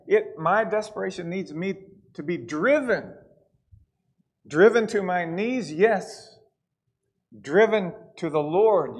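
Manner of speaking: loud, rising preacher's cry, leads held hard; preaching at full force. Acted. Preacher pleading with the Smithfield church, loud and fervent. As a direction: pour it out like a revival preacher.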